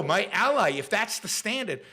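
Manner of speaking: ordinary talk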